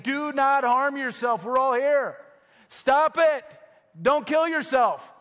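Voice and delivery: high, slow shout of warning; loud cry. Acted. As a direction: call out to stop the jailer. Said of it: "cried out in a loud voice".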